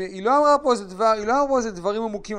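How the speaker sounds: normal talking voice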